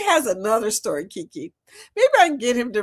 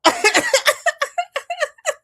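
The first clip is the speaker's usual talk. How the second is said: laughing; 3 dB louder than usual. Real, not acted. Laughing at own joke.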